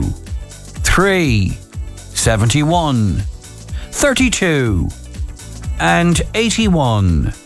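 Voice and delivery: each number drawn out slowly; slow number readback. Acted. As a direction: read the numbers back slowly. Reads back the numbers slowly.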